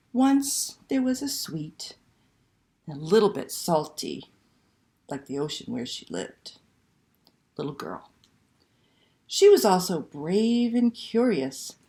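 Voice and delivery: slow, lively picture book reading; reading to children. Acted. Librarian reading Una to children online, expressive storytelling. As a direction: read it like a bedtime story to little ones.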